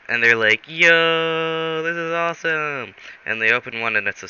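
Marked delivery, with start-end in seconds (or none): 0.7-2.9 high, long-held "Yo" of delight; hammed-up thrilled cheer; acted excitement. Friends finding presents on the ground, acted excitement.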